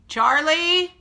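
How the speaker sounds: real yell across the house; loud, high-pitched shout for someone; calling out. Real, not acted. Yells for child who is screaming.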